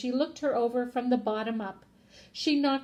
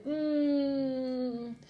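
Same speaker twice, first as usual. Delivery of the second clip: long, drawn-out squeezing hum; big hug hum; 2 semitones higher than usual. A narrator's reading. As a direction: hum as if squeezing someone tightly.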